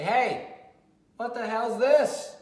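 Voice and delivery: a loud, high, drawn-out shout at the dog; sharp shout. Shown as a demonstration. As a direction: yell at the pup to make a scene. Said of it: "You just say".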